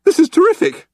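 very high-pitched, loud character voice; Arthur's thoughts. A narrator's reading. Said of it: "Arthur thought to himself".

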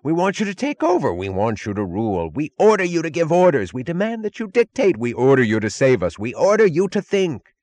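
high, loud, frantic overlapping demands; three watchers shrieking at Galt, high and frantic; panicked shrieks. Acted. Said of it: "indiscriminate shrieks".

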